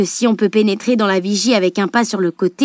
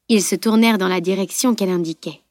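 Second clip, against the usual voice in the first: plain reading voice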